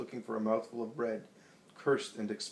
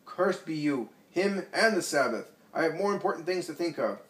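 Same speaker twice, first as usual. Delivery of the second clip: higher, louder furious outburst; old scribe curses Jesus before rushing out; 7 semitones higher, 7 dB louder than usual. A narrator's reading. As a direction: spit the curse and storm off. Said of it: "he rushes out furiously".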